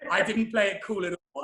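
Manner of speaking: ordinary talk, nothing marked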